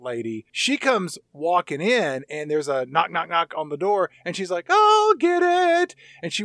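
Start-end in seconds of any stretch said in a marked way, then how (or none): 4.7-5.9 high, drawn-out singsong call; loud, stretched high-pitched holler. Acted. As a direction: sing out to answer the door. Acting woman answering the door.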